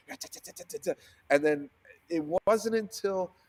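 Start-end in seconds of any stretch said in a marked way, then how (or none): 0.1-0.9 whispered ta-ta-ta chatter; unvoiced rapid tapping syllables; mock chatter. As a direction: rattle it off under your breath like a flood of comments. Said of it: "the comments were just like".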